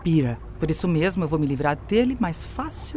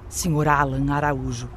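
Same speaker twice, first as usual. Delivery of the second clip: ordinary delivery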